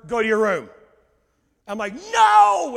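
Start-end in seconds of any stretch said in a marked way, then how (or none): 0.1-0.7 loud, retold mom's command; raised voice, sudden sharp command; shouted parental order. Wife orders son out, retold. 2.1-2.7 explosive high yell; one huge, drawn-out angry shout; screaming refusal. Retold or imitated. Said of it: "I'm screaming at him. I'm yelling".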